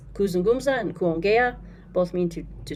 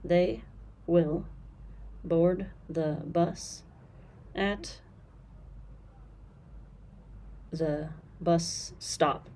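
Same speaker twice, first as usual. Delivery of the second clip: slow; 5 dB quieter, much slower than usual. Shown as a demonstration. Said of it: "same thing, stand, stop".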